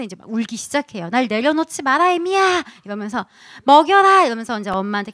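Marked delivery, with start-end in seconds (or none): 1.1-2.6 put-on baby bossing mom, rising high at the end; mock-commanding infant voice; demanding baby. Acts newborn demanding by crying. 3.6-4.2 high, loud mock command; imperious infant's order; demanding baby. Acts newborn demanding to be fed.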